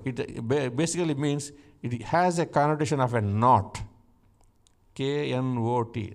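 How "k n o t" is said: K N O T spelled letter by letter, slowly. Shown as a demonstration. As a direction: spell out K N O T for the class. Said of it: "not N O T".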